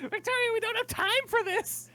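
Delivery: very high-pitched, pleading character voice; urgent protest. Acted. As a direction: squeak it out in exasperation. Daisy urges Victoria to hurry.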